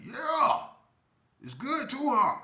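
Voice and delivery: loud, high, booming retort; mock big man shouting proudly; big vendor. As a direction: holler it in his face, high and loud. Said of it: "that big ass Indian looked me right".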